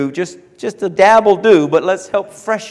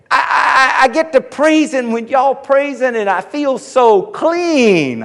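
higher, slow and dreamy; imitating the man; 7 semitones higher than usual. Big man telling the preacher how church feels, dreamy. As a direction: savour each word like the happy churchgoer. Man continues describing praise.

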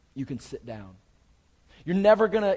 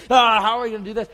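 mock panic; 5 semitones higher, 11 dB louder, much faster than usual. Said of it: "all trying to figure it out and calculators and stress".

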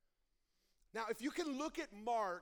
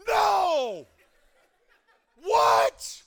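loud laughing disbelief, higher; shocked shout; 8 semitones higher, 20 dB louder than usual. Retold or imitated. Viewer reacting to the movie twist, laughing shocked shout. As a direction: yell it like the twist just hit you. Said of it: "you're like".

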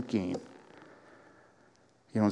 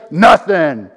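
denial; 7 semitones higher, 14 dB louder than usual. Retold or imitated. Grumbler denying.

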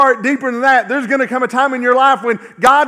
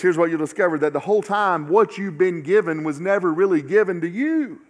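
ordinary talk, nothing marked

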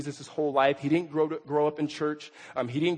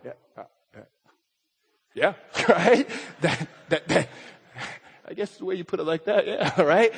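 awkward laughing agreement. Acted. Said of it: "we're kinda left going".

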